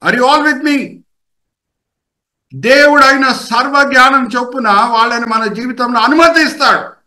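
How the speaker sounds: high, insistent preacher's delivery; impassioned preaching. Acted. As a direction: call it out high to the congregation. Preaches at full force.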